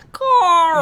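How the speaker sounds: high, loud drawn-out cartoon cry; exaggerated high-pitched cartoon exclamation; Llamas with Hats impression. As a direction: wail the name like a scolding cartoon llama. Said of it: "where it's just like".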